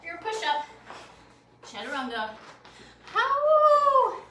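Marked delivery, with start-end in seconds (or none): 3.1-4.2 high, loud shout to the class; loud call-out. Acted. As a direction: call it out big and bright.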